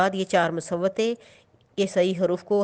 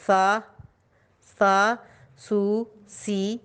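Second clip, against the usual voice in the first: put-on slow sing-song drill of syllables for children; teacher reading a syllable chart aloud; much slower than usual. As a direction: read each syllable clearly, like a teacher.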